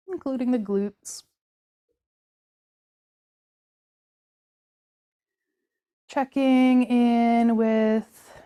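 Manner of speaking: slow, unhurried meditation voice with drawn-out words; guided body scan. Acted. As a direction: guide them gently through the body, leaving room to feel.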